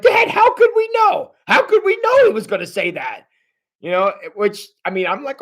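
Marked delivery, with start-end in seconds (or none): 0.0-3.3 high-pitched, whining protest to Dad; protesting brothers. Acted. Brothers defend themselves to their dad, exasperated protest. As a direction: cry out in mock exasperation. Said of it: "the brothers when they're all like".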